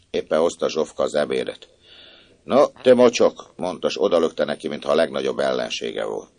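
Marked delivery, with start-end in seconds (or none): 2.4-3.5 contemptuous insult. A narrator's reading. Maid tosses food hatefully.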